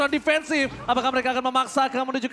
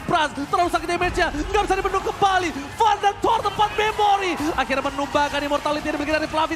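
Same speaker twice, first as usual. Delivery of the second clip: fighting game announcer; 6 semitones higher than usual. Real, not acted. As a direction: call the clutch save at full pitch. Caster builds to a clutch save. (komentator yang makin heboh)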